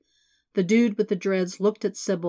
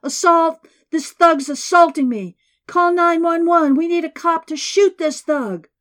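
high, much louder hysterical scream; screaming for help; 10 semitones higher, 10 dB louder than usual. A narrator's reading. Businessman faking victimhood to the car, shrieking. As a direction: shriek it like he's being attacked. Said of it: "the businessman screamed".

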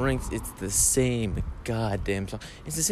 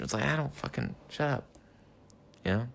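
ordinary talk

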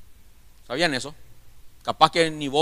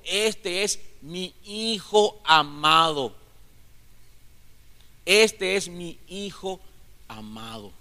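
very slow, hushed and reverent; slow solemn proclamation, fading away at the end; solemn voice of God; much slower than usual. Acted. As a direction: draw each word out like a voice from heaven. Preacher voicing God's words slowly.

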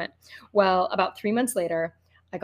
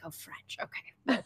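impressed whisper; 10 dB quieter, slower than usual. Retold or imitated. Hearing his accent, private aside.

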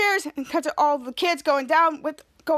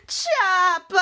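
high, loud call of a name; 4 semitones higher, 4 dB louder than usual. Acted. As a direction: shout across the room to your brother. Little sister calling out to her brother.

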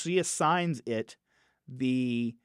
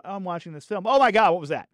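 rising to a startled outburst; sudden shocked viewer; shocked gasp; 6 semitones higher, 6 dB louder, much faster than usual. Retold or imitated.

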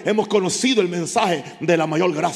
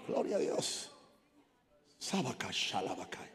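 breathy hushed whisper of praise; murmured praying in tongues; whispered prayer; 9 semitones lower, 15 dB quieter than usual. Real, not acted.

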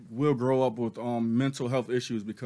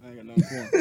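very high, laughing squeal; laughing refusal; 10 semitones higher, 4 dB louder, much faster than usual. Real, not acted. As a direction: squeal it out while cracking up.

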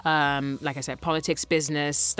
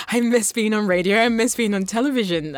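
wistful longing voice; 6 semitones higher, 4 dB louder than usual. Retold or imitated. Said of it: "And then I'm like".

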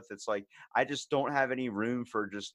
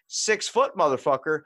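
higher and louder, throwing the insult back in disbelief; incredulous angry retort; 4 semitones higher, 5 dB louder than usual. Retold or imitated. Retelling how he answered the man in the doorway, outraged loud retort. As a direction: repeat his words back at him, outraged and loud. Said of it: "I was like".

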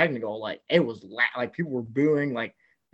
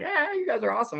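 cheery fan voice; 13 semitones higher than usual. Retold or imitated. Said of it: "all the Ole Miss families are like".

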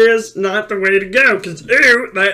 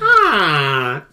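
ordinary delivery

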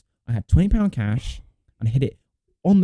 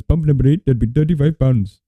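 imitated Indian accent; 2 semitones higher, 5 dB louder than usual. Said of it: "just do the accent".

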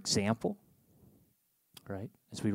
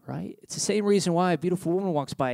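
usual speaking voice

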